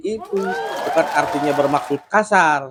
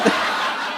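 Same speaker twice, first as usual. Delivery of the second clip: breathy laugh; 5 dB quieter than usual. Real, not acted. Laughing at the banner joke.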